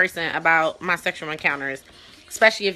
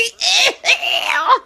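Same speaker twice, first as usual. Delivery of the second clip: high, loud laughing ew; 13 semitones higher, 5 dB louder than usual. Real, not acted. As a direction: shriek ew through a laugh. Grossed out by story.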